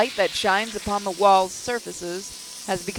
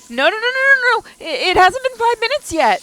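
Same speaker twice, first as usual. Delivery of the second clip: much higher, louder panicked cries; panicked screaming; 9 dB louder than usual. Acted. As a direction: shriek in panic as it fails. Portal collapsing, panicked outcry.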